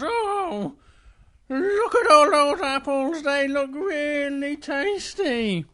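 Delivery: high, loud, drawn-out little bear voice; excited Little Bear. Acted. Little Bear spots apples in the orchard, excited. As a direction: gush slowly with eager delight.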